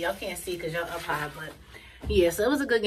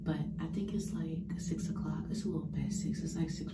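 whispering; 3 semitones lower, 7 dB quieter than usual. Real, not acted. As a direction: whisper so nobody wakes. Late at night, whispering.

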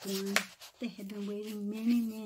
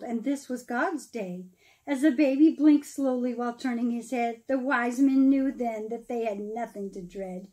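lively storybook read-aloud, rising and falling; storyteller reading verse aloud; reading to children; 2 semitones higher, 10 dB louder than usual. Acted. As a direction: read the Christmas rhyme to a room of kids. Reads Christmas poem to children.

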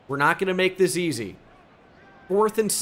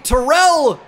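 a sudden high yell of the name; excited shout; 11 semitones higher, 9 dB louder than usual. Real, not acted. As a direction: shout the name as the ball flies. Defender nearly picks the pass.

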